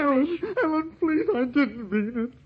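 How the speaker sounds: very high, crying plea; tearful begging. Acted. Guilty man begging his dead sweetheart, tearful. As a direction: wail for forgiveness in a guilty panic.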